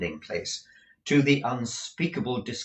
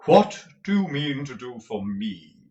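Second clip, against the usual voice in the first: pompous tragedian; 4 semitones higher, 8 dB louder than usual. A narrator's reading. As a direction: roll it out like a stage tragedian. Lenville's tragedian voice.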